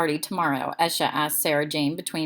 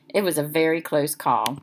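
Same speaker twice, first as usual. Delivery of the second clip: ordinary delivery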